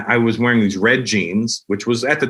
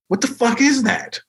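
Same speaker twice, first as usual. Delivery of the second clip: higher-pitched, mock-bewildered question; baffled disbelief; 12 semitones higher than usual. People puzzling over his race, baffled. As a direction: act out someone totally thrown.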